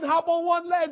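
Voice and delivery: ordinary talk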